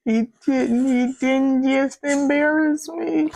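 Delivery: high, stretched-out wounded inner voice; inner hurt voice. Retold or imitated. Replaying her hurt to listeners, a high slow wounded inner voice. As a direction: voice the hurt you felt inside, drawn out and high. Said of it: "This is what I'm feeling on the inside".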